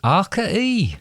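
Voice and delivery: high West Country voice; West Country accent. Shown as a demonstration. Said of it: "West Country".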